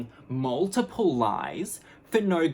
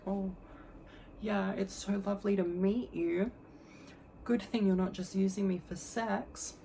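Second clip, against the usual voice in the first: high, sugary hostess voice; put-on coy sweetness; 7 semitones higher, 4 dB quieter than usual. Greeting her date in her robe, put-on coy sweetness. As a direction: coo it in a high, fake-innocent voice.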